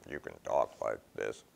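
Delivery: low, monotone buzzing electrolarynx voice; mock voice machine. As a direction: talk like a little throat machine.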